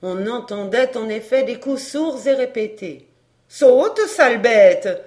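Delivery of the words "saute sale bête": louder angry grumble at the dog; grumbling. A narrator's reading. Maid scolding the dog in the kitchen: loud angry grumble. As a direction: snarl at the beast behind the door. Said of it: "grommelait Brigitte".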